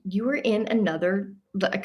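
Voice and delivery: ordinary talk, nothing marked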